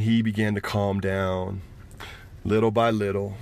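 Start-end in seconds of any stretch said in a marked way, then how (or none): none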